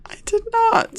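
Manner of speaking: squeaky-high protest of innocence; high-pitched denial. Real, not acted. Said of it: "I could hear it in your voice".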